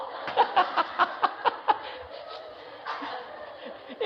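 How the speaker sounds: long breathy laugh trailing down. Real, not acted. Laughing at the story's punchline, long breathy laugh. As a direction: let the laugh run out.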